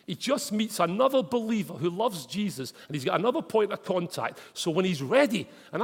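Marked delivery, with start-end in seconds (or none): none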